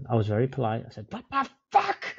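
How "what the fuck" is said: shocked outburst. Retold or imitated. Retold outrage at the beer.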